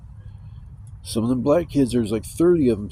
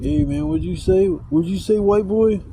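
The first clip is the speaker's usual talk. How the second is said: higher, rising mock challenge; confrontational challenge; 7 semitones higher, 4 dB louder than usual. Dudes turning round on him, confrontational imitation. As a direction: imitate someone spoiling for a fight, building in pitch.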